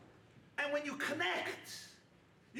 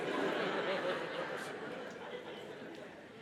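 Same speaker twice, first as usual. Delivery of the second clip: breathy chuckle; 7 semitones lower than usual. Real, not acted. After the neighbors joke.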